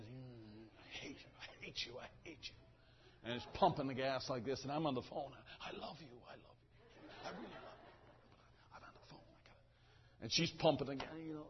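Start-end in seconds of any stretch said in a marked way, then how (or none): 0.0-2.5 whispered mock grudge, under the breath; tiny, repeated imitated mutter; muttered hatred. Mimics her silent glare while pumping. 5.6-10.2 barely audible mock-loving whisper; hushed, placating imitation; whispered affection. Mimed reply while on phone.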